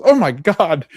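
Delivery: startled gasp. Retold or imitated. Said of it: "She, like, goes".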